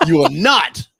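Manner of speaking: hammed-up forceful refusal; mock assertive. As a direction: lay down the law, playing it up loudly. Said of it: "So assertive, [name]".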